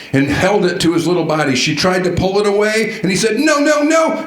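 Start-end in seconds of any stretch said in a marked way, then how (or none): 3.4-4.3 high mock toddler whine; toddler refusing. Toddler clutching candy refuses his mom, high mock whine. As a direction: squeal it like a stubborn two-year-old.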